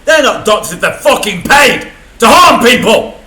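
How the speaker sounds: slow, loud furious tirade; pissed off. Real, not acted. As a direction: spit each word out slowly in real rage. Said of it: "God, I was pissed off".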